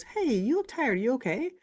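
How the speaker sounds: high, solicitous question; concerned check-in. Retold or imitated. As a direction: voice a client kindly checking on you. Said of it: "somebody comes into the clinic and goes".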